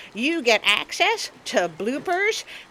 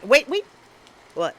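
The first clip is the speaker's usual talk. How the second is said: usual speaking voice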